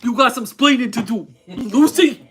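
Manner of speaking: put-on loud Cuban-New York accent, laughing; hammed-up Ricky Ricardo scolding Lucy; Desi Arnaz impression. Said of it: "with Desi Arnaz ... in New York".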